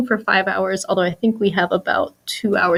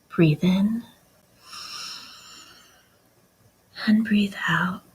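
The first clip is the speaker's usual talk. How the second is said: guided meditation; slower than usual. Shown as a demonstration. Guest demonstrates a meditation audiobook for the host, slow soothing voice. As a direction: guide the breath slowly, trailing into near-whisper. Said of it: "try to do a little thing".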